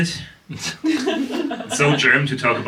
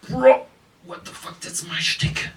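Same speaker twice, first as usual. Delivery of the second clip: hushed indignation; 11 semitones higher, 4 dB quieter, slower than usual. Retold or imitated. Retold protest at rival stealing his line.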